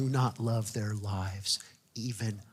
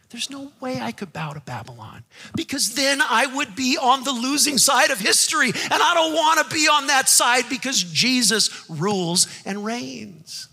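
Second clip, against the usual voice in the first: rising to full force; 11 semitones higher, 6 dB louder than usual. Acted.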